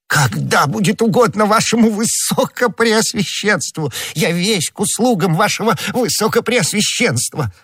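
very high, pleading servile voice; подобострастный Бонасье, трепет. Acted. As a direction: grovel in awe before a mighty master. Figure of Bonacieux, servile. (очень высокий угодливый голос, с трепетом)

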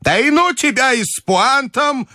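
put-on very high, testy old lady; irritated grandmother. A narrator's reading. Grandmother waving the general away, irritated. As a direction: brush him off in a high granny voice. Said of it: "раздражительно отмахнулась от него бабушка".

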